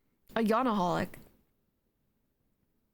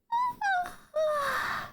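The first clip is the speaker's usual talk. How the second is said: long high yawning sigh. Real, not acted. Big yawn mid-sentence.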